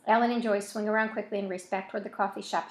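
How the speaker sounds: ordinary delivery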